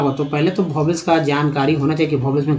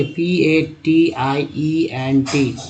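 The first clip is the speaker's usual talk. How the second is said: letters spelled slowly one by one; slow spelling out; much slower than usual. Shown as a demonstration. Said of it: "एक word ले लेते हैं patient". (धीरे-धीरे अक्षर बोलते हुए)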